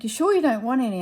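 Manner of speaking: husband teasing wife with bitter chocolate, loud and bouncy; pushy offer. Retold or imitated. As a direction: push it on her, loud and teasing. Husband pressing offer again.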